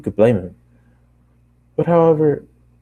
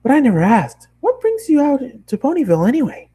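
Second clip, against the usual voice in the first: bubbly, much higher cartoon pony voice; high Pinkie voice; 4 dB louder than usual. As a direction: chatter it up high like an excited pony. Pinkie voiced in read story.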